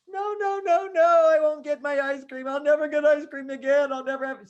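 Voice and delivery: high, loud mock wailing; hammed-up tantrum of hopeless protest; dramatic child despair. Daughter refuses father's promise of Sunday, dramatic wailing. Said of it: "more dramatic".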